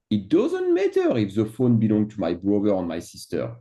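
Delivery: usual speaking voice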